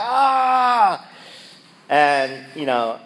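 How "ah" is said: high cry of pain. Retold or imitated. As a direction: cry out, stuck leg. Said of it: "I was like".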